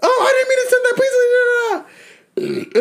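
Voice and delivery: very high, whiny fake-crying plea; high mock sobbing plea of the sister. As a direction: beg like a caught kid, hammed up. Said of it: "her ass like".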